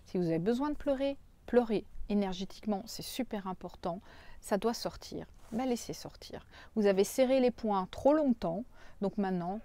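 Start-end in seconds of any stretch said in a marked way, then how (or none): 5.5-6.4 chuchoté, tout doux; murmure-le pour les laisser lâcher prise; whisper. Real, not acted. Coach urging release of emotions.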